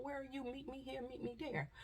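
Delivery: high, soft frantic caller; panicked phone call. Retold or imitated. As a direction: mimic someone on the phone in a panic. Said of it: "You hear people calling the phone".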